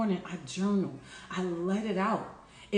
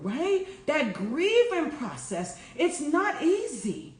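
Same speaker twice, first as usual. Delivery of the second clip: preaching; 4 semitones higher than usual. Acted. Healer preaching to her followers about grief, lifted sermon cadence. Rising preaching on old ways dying.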